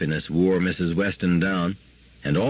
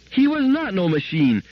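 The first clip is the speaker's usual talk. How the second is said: put-on fierce child's scream; 12 semitones higher, 4 dB louder than usual. A narrator's reading. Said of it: "screamed Gloria, fiercely".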